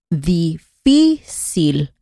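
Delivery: "difícil" drawn out very slowly, FÍ stressed; penultimate stress. Shown as a demonstration. Teacher slowly modelling "difícil" for learners, stress on FÍ. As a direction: spell out "difícil" syllable by syllable, leaning on FÍ. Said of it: "la sílaba más fuerte es la penúltima".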